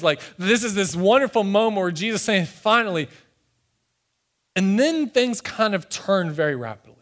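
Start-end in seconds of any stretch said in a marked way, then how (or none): 2.5-3.1 high-pitched, relieved exasperation; imitated exasperated relief. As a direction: exclaim it as if long awaited. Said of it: "exasperated sigh of relief".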